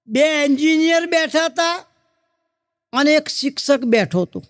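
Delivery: ordinary talk, nothing marked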